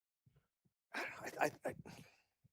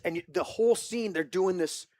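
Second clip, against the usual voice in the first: normal talking voice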